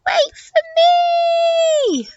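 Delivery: high, long-drawn shout after friends; calling out. Acted. Elephant left behind calling to friends, high drawn-out shout. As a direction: holler it high, stretching the last word. Eddie calls after the others.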